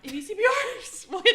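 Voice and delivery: high, loud laughing repeat. Real, not acted. Host echoing her cohost's CPR joke, laughing loudly. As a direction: crack up while echoing the joke.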